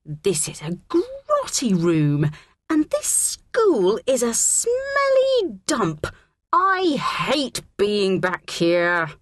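slow, high, whiny tantrum; disgusted schoolgirl wail; loud complaining.

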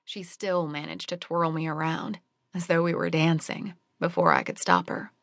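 ordinary delivery

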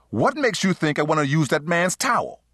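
high-pitched, irritated outburst; snapping. A narrator's reading. Man confronts his lover over her husband's towel, snapping. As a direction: snap at her, pitch jumping up. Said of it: "Genesis snapped".

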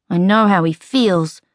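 ordinary delivery, nothing marked

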